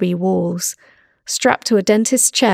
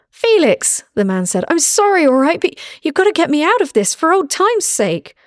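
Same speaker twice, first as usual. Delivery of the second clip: high, strained begging voice; pleading captive; 9 semitones higher than usual. Acted. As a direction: beg an old friend for help. Bound captive begging old friend.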